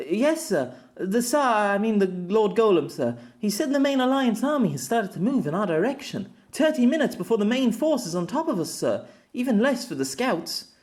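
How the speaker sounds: put-on a young gopher blurting urgent news to his commander; anxious squad boy voice; breathless young runner. Young runner reports to officer.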